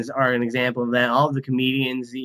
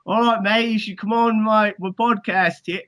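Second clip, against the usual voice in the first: higher-pitched mock Australian; bad Australian accent; 6 semitones higher than usual. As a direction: put on a clumsy Aussie accent, badly. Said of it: "I'm trying to do an Australian accent".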